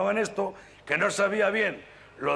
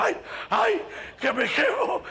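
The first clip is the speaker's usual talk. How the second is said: much higher pained yelp; panicked yelp; slower than usual. Acted. Bus driver pulls off his burning coat, panicked yelp. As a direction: cry out as the fire bites. Driver's back catches fire.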